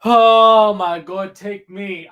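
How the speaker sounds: loud, drawn-out oh my God; loud overwhelmed exclamation. Real, not acted. Overwhelmed by the trial, loud slow exclamation. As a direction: cry it out, overwhelmed.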